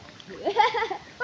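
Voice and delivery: laughing. Real, not acted. Laughing at slipping.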